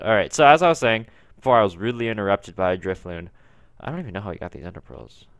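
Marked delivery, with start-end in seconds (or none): none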